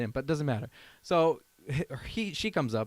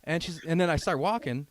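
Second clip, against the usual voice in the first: normal talking voice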